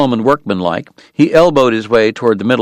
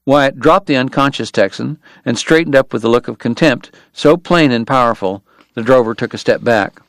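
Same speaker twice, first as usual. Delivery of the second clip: ordinary delivery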